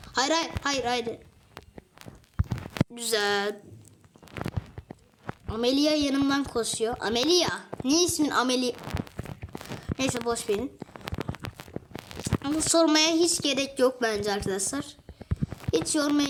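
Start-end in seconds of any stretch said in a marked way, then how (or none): none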